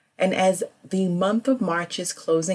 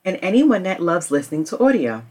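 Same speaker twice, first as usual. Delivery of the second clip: ad read; 3 semitones higher than usual. Acted. Host reading a sponsor ad to listeners, upbeat ad read.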